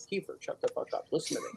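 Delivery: sudden hushed mock-menacing whisper. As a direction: drop to a breathy threat, voiceless. Mock-threatening whisper to guest.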